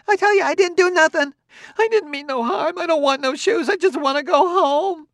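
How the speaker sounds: high, fast, frantic pleading; pleading wail. A narrator's reading. Frightened woman pleading with the robot. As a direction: beg to be let go home. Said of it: "yelled the woman".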